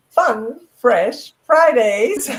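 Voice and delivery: slow, loud, punchy title call; show-title announcement. Real, not acted. Host signing off to viewers, drawn-out show-title announcement. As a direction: punch out each word for the viewers.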